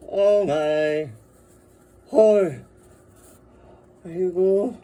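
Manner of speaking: loud high oohs and aahs of awe; delighted moaning. Real, not acted. Marvelling at the badges, loud awed moans. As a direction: moan in wonder at each detail. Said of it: "just moaning and screaming".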